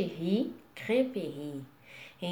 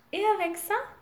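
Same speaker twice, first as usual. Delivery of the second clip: bright, high clerk's question "avec ça"; chirpy shop clerk asking; shop assistant; 10 semitones higher than usual. Pretend. Shop clerk asking a customer, high and chirpy. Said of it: "Eles podem falar isso pra você".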